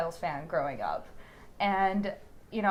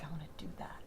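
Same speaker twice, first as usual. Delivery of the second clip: whispered wish; 3 semitones lower, 13 dB quieter, much faster than usual. Retold or imitated. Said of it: "child wanting to act".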